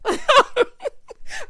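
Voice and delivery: very high, loud cackle; real laugh. Real, not acted. Host recapping Ryan's remark, laughing out loud.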